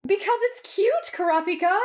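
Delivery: very high, cutesy squeal; gleeful teasing. A narrator's reading. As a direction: tease him in a high, playful voice. Heroine teasing her mortified partner.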